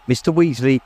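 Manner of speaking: ordinary delivery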